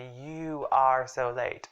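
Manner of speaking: slow, "You are" as two full words; emphatic, drawn-out "You are"; separated for emphasis. Shown as a demonstration. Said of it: "we just separated for emphasis".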